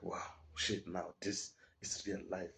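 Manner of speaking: slow, hushed, voiceless whisper; whispering to himself, scared, under his breath; whispered dread. Retold or imitated. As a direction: breathe it out as the danger sinks in. Hearing them plan his killing.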